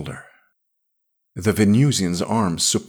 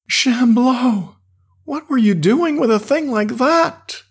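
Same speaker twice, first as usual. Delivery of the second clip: horrified exclamation; 5 dB louder than usual. A narrator's reading. Yarrell horrified.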